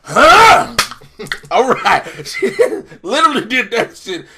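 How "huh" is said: normal talking voice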